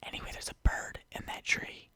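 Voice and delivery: "there's a bird in that tree" whispered, no voice. Real, not acted. Whispering to viewers about a bird outside. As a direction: breathe the line out as a whisper. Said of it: "I'ma get really quiet and close here".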